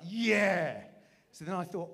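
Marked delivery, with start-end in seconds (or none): 0.1-0.8 loud, higher proud yeah. Retold or imitated. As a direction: answer back, pumped up. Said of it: "And I was like".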